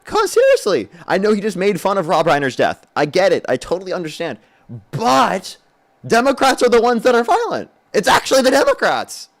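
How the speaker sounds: high, hammed-up pleading with the crowd; mock speechmaker. Debater parodying a supporter blaming Democrats, high mock speechmaker. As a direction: push it way up into a parody of earnest appeal.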